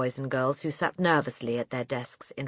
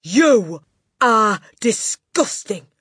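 slow, loud, high bellow; bellowed disgust; 7 semitones higher, 12 dB louder, much slower than usual. A narrator's reading. Headmistress disgusted with the boy, bellowed. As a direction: bellow it one word at a time. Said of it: "the Trunchbull bellowed".

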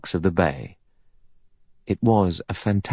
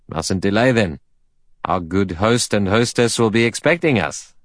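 hearty colonial rounding up the party; bluff man; 2 semitones higher than usual. A narrator's reading. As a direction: play a big, breezy man hurrying guests along. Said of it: "a big, bluff man".

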